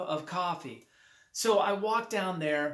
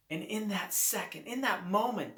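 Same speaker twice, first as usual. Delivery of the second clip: normal talking voice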